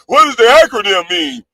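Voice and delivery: a high, whiny mock of the chat asking about the loan; mocking the askers. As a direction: ham up the nosy, worried chatters. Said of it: "people that wanna come up and ask me".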